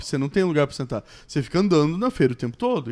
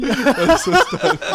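laughing; 10 semitones higher, 7 dB louder than usual. Real, not acted. Laughing repeat of a joke.

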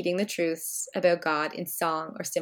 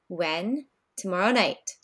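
ordinary talk, nothing marked

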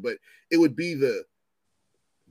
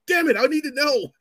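frustrated outburst; 12 semitones higher, 6 dB louder than usual. Acted. Frustration over unknown outcome.

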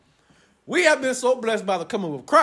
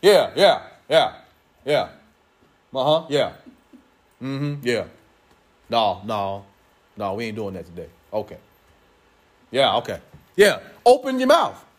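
chatty phone call; 9 semitones lower than usual. Retold or imitated. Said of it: "You know how you be on a cell phone".

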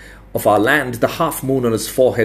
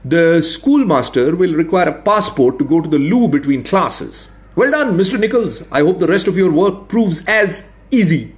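higher, dryly mocking; sarcastic administrator; 7 semitones higher, 3 dB louder than usual. A narrator's reading. Veteran administrator ridiculing the expert's border, sarcastic. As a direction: lay out the absurdities with polite scorn.

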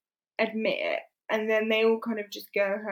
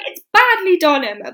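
loud, higher reproach, dropping on the name; passionate scolding; 4 semitones higher, 8 dB louder than usual. Retold or imitated. Mr Knightley scolding Emma over Miss Bates, passionately. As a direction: imitate an impassioned telling-off. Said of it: "So passionately".

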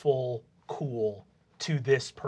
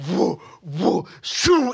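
vocal whooshes of someone flung away; whooshing spin sound effect; 7 dB louder, much slower than usual. Acted. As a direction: imitate spinning, then launching. Imitates spinning and flinging the bully.

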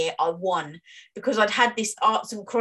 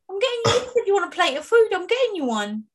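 excited; 12 semitones higher, 4 dB louder, faster than usual. Real, not acted. Teasing promise of food.

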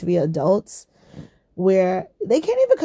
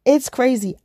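usual speaking voice

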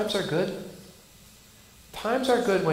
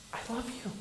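I love you almost breathed, much softer; soft I love you spoken to hurting people; hushed tender; 4 semitones higher, 14 dB quieter, faster than usual. Acted. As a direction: lean in and say it very softly. God's words to his people.

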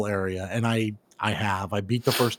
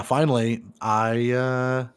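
usual speaking voice

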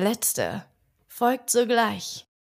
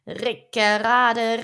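slow, louder rumbling Rickerade, stretched out; drawn-out mill sound; 5 dB louder, slower than usual. A narrator's reading. Narrator imitating the mill crunching the boys, slow and louder. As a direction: grind out Rickerade like the turning mill wheel.

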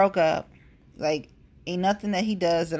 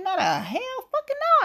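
emphatic refusal. Real, not acted. Emphatic refusal to trust government.

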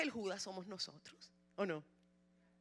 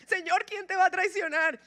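much higher and louder, anxious; urgent question; 8 semitones higher, 10 dB louder than usual. Acted. Disciple anxiously pressing Jesus for the traitor, loud and high. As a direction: blurt it out in alarm. John asks Jesus again.

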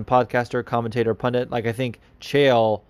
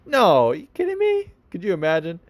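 much higher, mock disbelief; incredulous scoff; 7 semitones higher than usual. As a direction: laugh off an absurd idea in a high put-on voice. Chael scoffing at the question.

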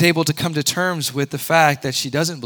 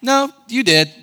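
playful God; 7 semitones higher than usual. Retold or imitated. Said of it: "It's like".

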